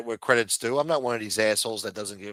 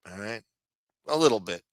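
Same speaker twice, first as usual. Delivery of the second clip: ordinary talk, nothing marked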